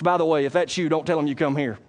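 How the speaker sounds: usual speaking voice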